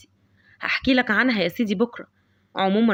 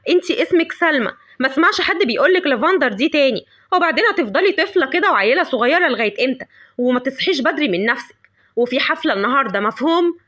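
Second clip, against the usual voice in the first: yelling mother; 3 semitones higher, 4 dB louder than usual. Acted. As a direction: shout down the daughter. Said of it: "فضلت تزعق وتقول". (أم بتزعق بصوت عالي)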